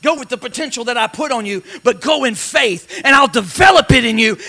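ordinary talk

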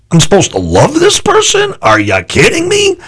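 as if the very idea were a joke; incredulous. Retold or imitated. Pastor to himself on loving his enemy, high incredulous scoff.

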